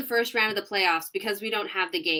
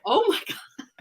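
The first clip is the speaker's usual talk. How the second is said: amazed; 10 semitones higher than usual. Retold or imitated. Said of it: "And I was like".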